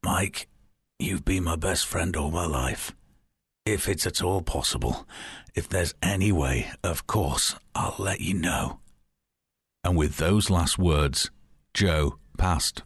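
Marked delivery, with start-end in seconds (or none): 0.0-8.8 weak, hoarse and hushed; hoarse whisper. Acted. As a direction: rasp it out softly like a dying man. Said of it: "whispered hoarsely".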